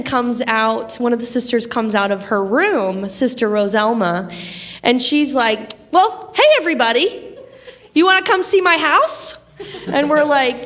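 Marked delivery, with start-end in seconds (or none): 5.9-9.4 put-on sweet old lady. Sweet old sister greeting students.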